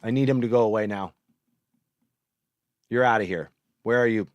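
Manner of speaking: ordinary talk, nothing marked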